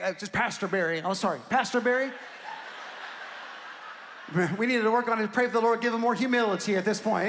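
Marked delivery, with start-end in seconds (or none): 2.1-3.9 quiet breathy laugh. Real, not acted. Laughs at own correction.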